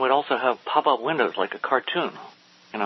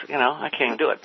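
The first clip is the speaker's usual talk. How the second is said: ordinary delivery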